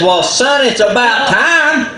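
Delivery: high, laughing braggart voice; boastful Possum. Acted. Possum bragging to Coyote, high laughing swagger. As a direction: crow about it like a vain show-off. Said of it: "And Possum says".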